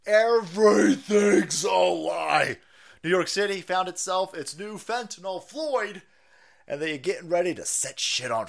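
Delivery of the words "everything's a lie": drawn-out shout, slow. Real, not acted. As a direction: bellow it out slowly. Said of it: "repeated slower shouted".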